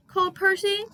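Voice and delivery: ordinary delivery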